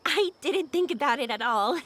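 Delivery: ordinary talk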